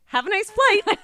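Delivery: high, loud, laughing goodbye; laughing brush-off. Retold or imitated. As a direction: chirp it and run. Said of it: "I just picked up my bag and I said".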